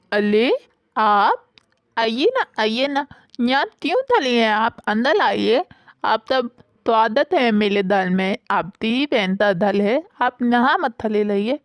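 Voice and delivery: put-on childlike lisp, r and k turned to l and t; lisping girl. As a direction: lisp every r and k sound like a small child. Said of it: "ये अपनी तोतली आवाज़ से मुझे तो bore मत करो". (तोतली आवाज़ में बोलना)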